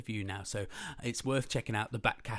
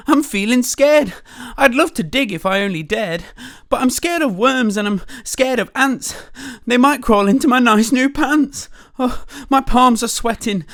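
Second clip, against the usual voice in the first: high, loud trembling fright; scared cook; 12 semitones higher, 18 dB louder than usual. Acted. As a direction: wail it like a wimpy cook. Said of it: "the cook declared".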